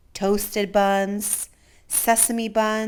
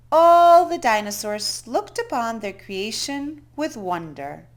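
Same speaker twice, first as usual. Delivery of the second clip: ordinary delivery, nothing marked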